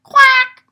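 a loud, high duck quack in class. A narrator's reading. Boy quacking instead of answering the teacher, loud and high. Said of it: "he just said".

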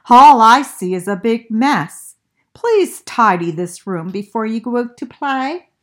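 mild scolding mother. Acted. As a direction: firmly tell him to tidy up.

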